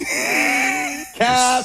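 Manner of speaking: high mock shout of slang; ironic zoomer. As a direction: yell it like a teen calling a bluff. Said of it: "talk like a Gen Z person".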